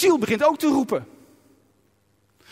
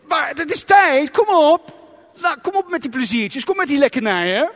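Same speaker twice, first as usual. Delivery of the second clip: raised, higher nagging voice of the body; voice of the hungry body; 4 semitones higher, 4 dB louder than usual. Pretend. Preacher voicing his body demanding food. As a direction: whine for chips and McDonald's. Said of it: "Die schreeuwt".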